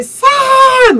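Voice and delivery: high, loud drawn-out scream; retold scream. Running to her injured friend, retold scream of her name. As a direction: scream the name as you run to them. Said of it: "I started screaming".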